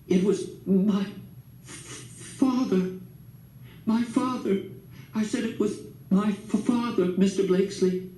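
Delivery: high, faltering confession; shaken voice. A narrator's reading. Miss Love confessing to Grandpa, shaking and high. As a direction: stumble over the word, voice shaking. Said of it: "her voice was shaken".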